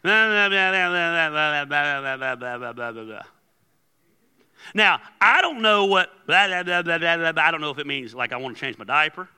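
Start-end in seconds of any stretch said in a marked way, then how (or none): 0.0-3.2 imitated toddler babbling, sliding down in pitch; long run of mock toddler gibberish; toddler babble. Shown as a demonstration. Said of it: "They talk like this". 6.3-7.4 imitated toddler gibberish; mock baby-talk nonsense; toddler babble. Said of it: "They talk like this".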